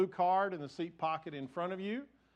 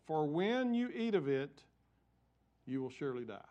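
scripture reading; 3 semitones higher than usual. Acted. Reads scripture aloud.

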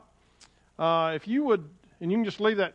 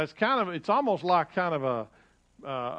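ordinary talk

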